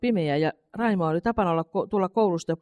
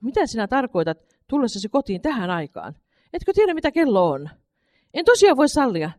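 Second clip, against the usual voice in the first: a stern mother's scolding, rising higher and louder; 5 semitones higher, 4 dB louder than usual. A narrator's reading. Mother scolds her son for coming home late, sternly. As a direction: scold your late son, getting sharper. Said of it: "sanoi äiti totisena".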